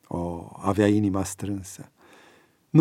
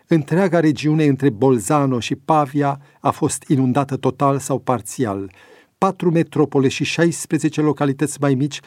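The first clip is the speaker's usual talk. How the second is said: brisk, higher-pitched bulletin delivery; news read; 4 semitones higher, 3 dB louder than usual. A narrator's reading. Breaking news on the tablet about floods in Italy, news read.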